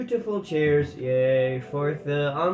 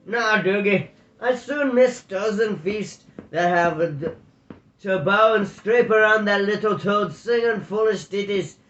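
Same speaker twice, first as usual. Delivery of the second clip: higher, louder scornful Border burr; broad Scottish Border accent; 5 semitones higher, 5 dB louder than usual. Acted. Tam scorning the feast to Doogie, broad Border accent. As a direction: sneer at the little toad in a broad Border brogue. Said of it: "Tam accent was as broad as Doogie's".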